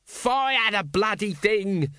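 high, drawn-out panicked scream. A narrator's reading. Nobby begs Colon to shoot, screaming. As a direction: scream it in panic, without full volume. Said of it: "screamed Nobby".

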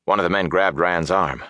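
plain reading voice

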